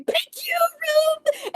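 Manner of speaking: very high mock scream without full volume; screamed thanks. Meadow to the puzzle room once the water drains, mock screaming. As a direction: squeal it high, hammed-up. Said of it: "at the top of their lungs is just screaming".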